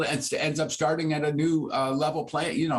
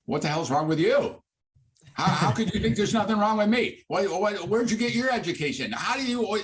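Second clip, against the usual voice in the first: imitating an indignant patient, pitched much higher, a laugh breaking in; hammed-up disbelief, climbing higher as it goes; mock outraged patient; 9 semitones higher than usual.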